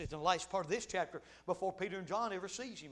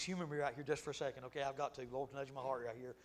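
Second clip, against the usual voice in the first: ordinary talk, nothing marked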